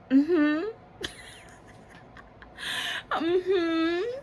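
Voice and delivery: high giggly mm-hmm; awkward laughing mm-hmm. Retold or imitated. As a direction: squeak mm-hmm through embarrassed laughter. Awkward reply after kiss.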